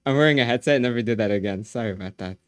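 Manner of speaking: ordinary talk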